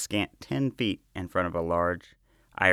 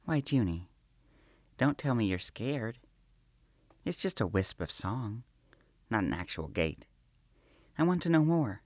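lightly voiced, higher playful princess; teasing princess voice; 4 semitones higher than usual. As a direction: coax and tease her timid friend. Princess teasing her companion.

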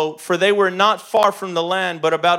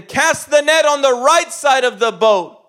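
raised, loud commanding call; called-out instruction; 7 semitones higher, 7 dB louder than usual. Acted. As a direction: call it out to men in a boat. Jesus calls instructions to fishermen.

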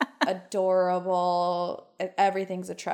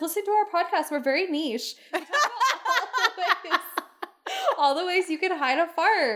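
giddy pitch; 8 semitones higher than usual. Retold or imitated. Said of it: "I was like".

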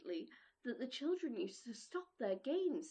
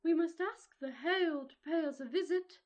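north wind voice; 4 semitones higher, 5 dB louder than usual. Acted. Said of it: "he said".

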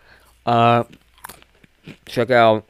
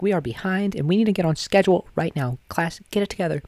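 teacher voice; 9 semitones higher than usual. Retold or imitated. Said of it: "And I'd be like".

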